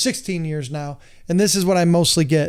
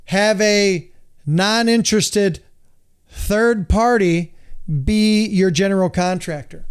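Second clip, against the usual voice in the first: slow emphatic delivery; slower than usual. Real, not acted. Emphatic list point.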